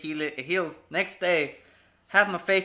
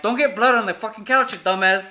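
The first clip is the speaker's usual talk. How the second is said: loud, raised-pitch mock scolding; imitated angry parent; 4 semitones higher, 7 dB louder than usual. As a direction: tell the kid off, playing the parent. Retold parents scolding over couch.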